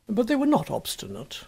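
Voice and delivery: ordinary delivery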